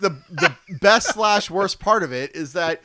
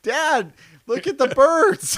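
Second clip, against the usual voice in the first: put-on high, loud kid squeal with laughter; mock childlike glee, pitched way up; imitating thrilled child; 10 semitones higher, 5 dB louder, slower than usual. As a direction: play an overjoyed little girl calling to dad. Voicing his delighted little daughter.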